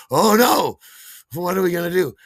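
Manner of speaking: loud, high mock dismay; hammed-up "what now" panic. Storyteller crushing on another dancer, mock panic.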